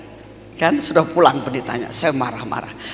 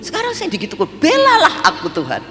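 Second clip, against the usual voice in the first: much higher, rising pleading rant; ranting prayer; 5 semitones higher than usual. Retold or imitated. Angry wife complaining to God, high pleading rant. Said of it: "saya ngoceh sama Tuhan".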